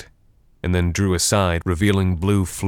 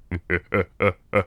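undead laugh. A narrator's reading. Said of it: "he began to laugh even harder".

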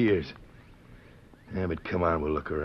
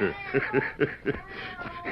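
mocking chuckle; 7 semitones higher, 3 dB quieter than usual. Mocking laugh at the cat.

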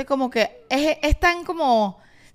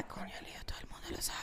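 barely audible voiceless whisper; whispered echo; 24 dB quieter, faster than usual. Retold or imitated. Director behind the camera mouths along with the actress's line, whispered echo. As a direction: whisper the line along under your breath.

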